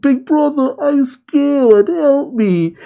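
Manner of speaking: high-pitched mock little-brother plea; mock scared. Bear guy mocking Gas as scared of wetting himself, mock scared. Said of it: "He was so scared".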